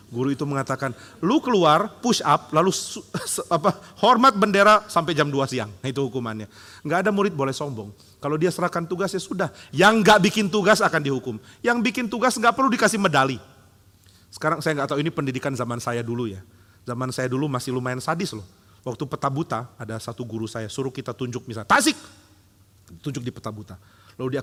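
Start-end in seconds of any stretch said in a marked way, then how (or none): 1.2-5.7 teacher shouting orders. Acted. Strict teacher punishing student. 21.7-22.0 sharp high shout of a town name; a strict teacher firing a place name at the class, shouted high; teacher calling out. Retold or imitated.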